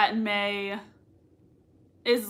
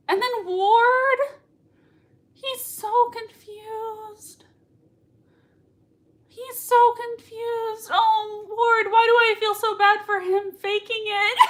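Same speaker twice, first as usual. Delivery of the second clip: much higher, slow, near-crying voice; tearful gush; 10 semitones higher, slower than usual. Real, not acted. Fan pitying Ward to viewers, tearful high gush. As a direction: whine it as if about to cry.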